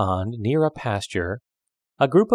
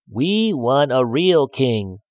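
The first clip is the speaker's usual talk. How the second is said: slow, higher frog voice; frog pleading; 4 semitones higher, slower than usual. A narrator's reading. Frog at the back door asks the man for a real king, pleading slowly. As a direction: draw out the demand like a small frog.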